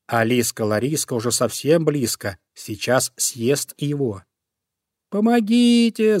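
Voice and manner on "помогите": high loud slow shout; cry for help. Acted. Bunny screams for help as the fox comes, high and loud. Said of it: "закричал зайка Петя".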